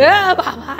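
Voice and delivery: high, loud mock surprise; loud playful oh my. Real, not acted. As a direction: act startled at the grumpy dog. Dog gets angry.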